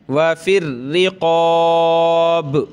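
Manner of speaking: व फिर रिक़ाब with a doubled hard रा and a thick क़ाफ़; slow recitation of व फिर रिक़ाब, heavy क़ाफ़; hard ra, heavy qaf. Shown as a demonstration. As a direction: chant व फिर रिक़ाब, pressing रा and deepening क़ाफ़. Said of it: "रा को सख्त करेंगे, क़ाफ़ को मोटा करेंगे".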